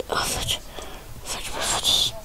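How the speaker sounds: soft whispered curses. Real, not acted. Gamer whispering frustrated curses at his loss, whispered. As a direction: curse under your breath.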